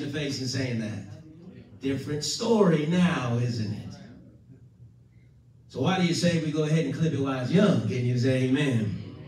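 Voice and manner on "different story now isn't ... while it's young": normal talking voice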